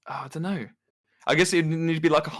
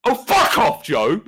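high, loud acted rage; hammed-up British fury; angry yelling; 9 semitones higher, 5 dB louder, slower than usual. Brit yells at his friend on request, acted angry shouting. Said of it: "hearing [name] get angry".

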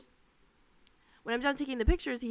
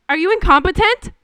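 loud, high, shrill-free mock shout; shouted insult; 10 semitones higher, 15 dB louder than usual. Manager shouting at the server, loud. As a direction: hurl the question at her loudly. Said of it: "she proceeds to say".

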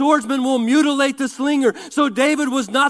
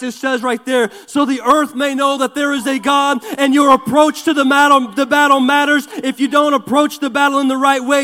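loud, driving sermon delivery; rally the church to get excited; full-force preaching; 4 dB louder than usual. Acted.

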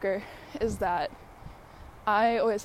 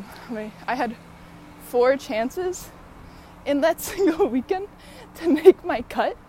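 excited laughing; 5 semitones higher, 5 dB louder than usual. Real, not acted. Excited laughing retelling big meet.

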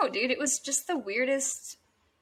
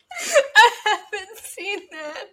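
laughing; 8 semitones higher, 5 dB louder, much slower than usual. Real, not acted. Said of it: "Oh, that's so funny".

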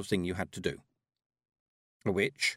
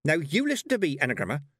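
higher, louder warning; fired-up telling-off; indignant; 6 semitones higher, 4 dB louder than usual. A narrator's reading. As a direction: confront a rude friend firmly. Petulia angrily confronts her sneering friend.

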